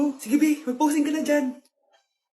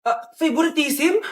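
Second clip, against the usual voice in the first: ordinary talk, nothing marked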